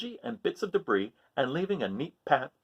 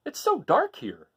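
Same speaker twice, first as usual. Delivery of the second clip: higher, slow uneasy voice; uneasy crab; 8 semitones higher, slower than usual. Acted. Hermit Crab in the dark seaweed forest, slow and higher. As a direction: peer into the gloom, unsure. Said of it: "thought Hermit Crab".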